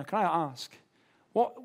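usual speaking voice

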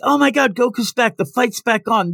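high-pitched imitation of thrilled onlookers; excited crowd. Crowd seeing Goku revive, excited. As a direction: exclaim it like an amazed crowd. Retold crowd cheering Goku's return.

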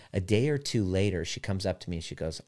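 usual speaking voice

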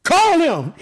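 high, firm command; Jesus halting the road, calling out. Retold or imitated. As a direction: stop and order them to bring him. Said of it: "Jesus stopped and said".

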